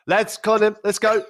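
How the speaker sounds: chirpy assistant rallying the lads; a touch of an excited assistant coach; imitating the assistant. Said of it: "the assistant come on and was like".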